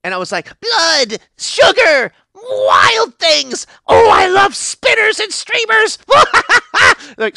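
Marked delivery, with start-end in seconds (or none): 0.6-6.0 very high, loud frenzied babble; hammed-up frenzy, pitched way up; manic shrieking. 6.0-7.1 very high, loud giggle; hammed-up crazed laugh; manic cackle.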